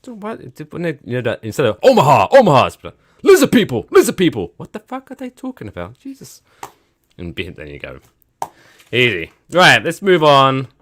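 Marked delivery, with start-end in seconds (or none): 0.0-6.4 baffled exasperation; high incredulous squeak. Real, not acted. Incredulous ranting about conspiracy.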